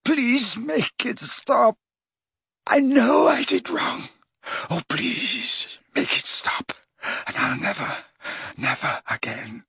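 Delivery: high, slow pleading fading to a hush; desperate begging. A narrator's reading. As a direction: beg brokenly, fading out. Dumbledore begging in torment.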